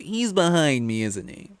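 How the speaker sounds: high, slow mocking Kiwi-style quip; hammed-up sitcom line delivery; Joss Whedon quip. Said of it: "Joss Whedon or whatever with the whole".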